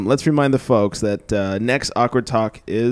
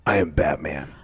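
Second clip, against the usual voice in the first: Batman voice; 6 semitones lower, 4 dB quieter than usual. Pretend. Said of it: "That wasn't even Batman-ish".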